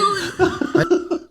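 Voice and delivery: high, giddy burst of laughter; cracking up uncontrollably; helpless laughing fit. Real, not acted. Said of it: "Para na naman akong baliw dito sa kakatawa". (tawang hindi mapigilan)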